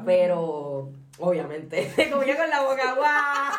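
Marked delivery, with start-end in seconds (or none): 2.0-3.5 loud, raised-pitch laughing shout; full-on yelling. Shown as a demonstration. Showing how they used to yell, loud laughing shout. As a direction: belt it out, half laughing. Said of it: "antes era de gritar full".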